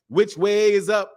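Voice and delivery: high, slow mock question, then a squealing laugh; mocking joke quote. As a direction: ham up the joke they crack. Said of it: "cracking jokes like".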